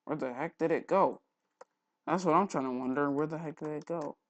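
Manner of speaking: ordinary talk, nothing marked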